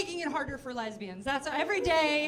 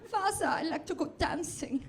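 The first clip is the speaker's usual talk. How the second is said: put-on camp German accent, slightly raised pitch; lightly lifted, campy German-accented English; 4 semitones higher than usual.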